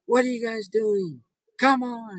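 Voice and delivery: mock-angry shout at teenage boys; angry call. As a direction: yell at the teens to get back here. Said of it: "I got mad at them".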